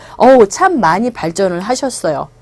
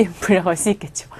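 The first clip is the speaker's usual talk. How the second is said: whispered joking aside; mock whisper; 4 semitones lower, 7 dB quieter, faster than usual. Real, not acted. 학생들에게 농담처럼 속삭임. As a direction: lean in and whisper it.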